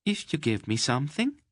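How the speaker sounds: Mady teasing the shy stranger, archly; arch tease. Acted. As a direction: dangle the offer with a sly lilt. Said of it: "looking at him archly".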